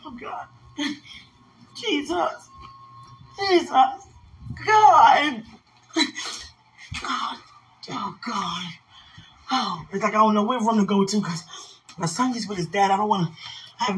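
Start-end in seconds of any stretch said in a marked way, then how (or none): 0.0-8.7 high, broken sobbing prayer; crying. Real, not acted. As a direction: weep out to God. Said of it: "I don't want to hear me cry".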